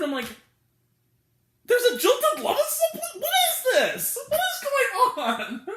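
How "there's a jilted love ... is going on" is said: high, loud bewildered cry; incredulous outburst. Real, not acted. Critic baffled by a surprise subplot, high incredulous outburst. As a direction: wail it in comic disbelief, stretching the end.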